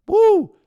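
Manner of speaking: high, loud mock whoop of joy; imitated celebration whoop. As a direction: whoop like a coach celebrating a late goal. Coach celebrating a goal.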